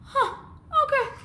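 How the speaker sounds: tiny high-pitched grudging okay; taken-aback reply. Retold or imitated. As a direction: squeak out a stunned acceptance.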